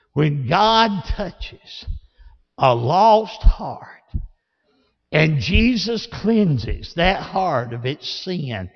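ordinary talk

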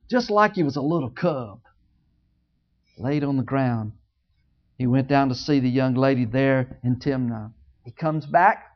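ordinary delivery

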